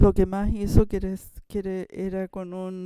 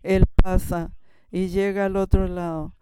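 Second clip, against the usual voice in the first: ordinary delivery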